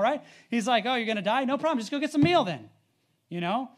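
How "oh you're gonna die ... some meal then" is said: unfazed nonchalance. Retold or imitated. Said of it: "he's just, just calm about it".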